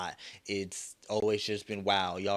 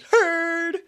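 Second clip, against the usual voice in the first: "Heard." shrieked much higher and louder as a mock; hammed-up parody of a curt coworker; 13 dB louder than usual. Mocking the supervisor's reply.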